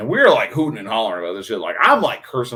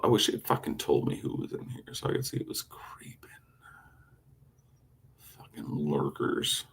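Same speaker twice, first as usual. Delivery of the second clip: whispered grumble; 5 semitones lower, 7 dB quieter than usual. Real, not acted. Muttering at lurkers.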